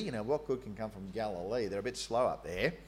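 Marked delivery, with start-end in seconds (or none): none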